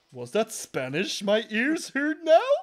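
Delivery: slow, loud, rising incredulous question; hammed-up suspicion, climbing to the end; mock suspicious agent.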